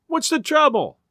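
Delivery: high-pitched anxious cry; cried out. A narrator's reading. Woman demanding news of the delay, crying out. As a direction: call out in a high voice. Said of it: "a woman cried".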